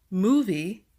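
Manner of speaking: "movie" with the first syllable stressed, MO-vie. Shown as a demonstration. Teacher giving quiz answer number three, MO stressed in "movie". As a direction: lean on MO in "movie", lighter on the second syllable. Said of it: "the M O is stressed".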